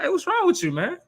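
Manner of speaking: a hint of a sharp wife calling him out; Jezebel confronting. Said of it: "she was like".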